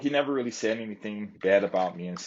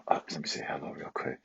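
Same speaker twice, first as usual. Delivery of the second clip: quick whispered aside; 4 semitones lower, 7 dB quieter than usual. Real, not acted. Vlogger breaks off his story to greet a viewer in a whisper. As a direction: whisper an aside off to one side.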